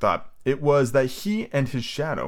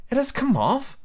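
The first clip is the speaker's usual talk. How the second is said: put-on high girl voice. Wendy voiced.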